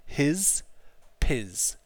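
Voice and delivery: his then piz, each ending on a voiced z; rhyme piz with his. Shown as a demonstration. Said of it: "like his".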